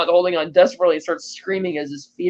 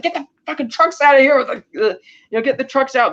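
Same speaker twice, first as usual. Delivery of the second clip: urgent retold command, pitched up; mock-urgent boss giving orders; 6 semitones higher than usual. Drug boss ordering the trucks out of a fire, urgent.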